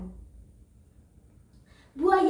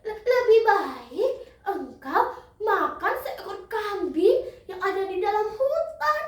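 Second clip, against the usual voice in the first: high-pitched, stammering duck with frantic quacks; terrified duck; 10 semitones higher than usual. Acted. Duck begs crocodile not to eat him, high frightened pleading. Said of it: "Bebek pun menjerit ketakutan".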